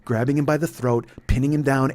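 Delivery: ordinary delivery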